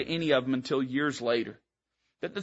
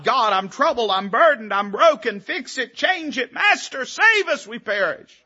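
rising, ever higher pleading prayer; desperate cry to God; 7 semitones higher, 5 dB louder than usual. Acted. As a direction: build from complaint to a frantic high plea. Believers crying out to God.

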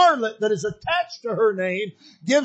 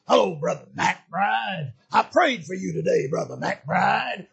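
low, gravelly preacher; 2 semitones lower than usual. Retold or imitated. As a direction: imitate a preacher friend's low gravelly greeting. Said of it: "He had this real low, gravelly preacher voice".

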